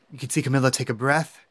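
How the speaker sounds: ordinary talk, nothing marked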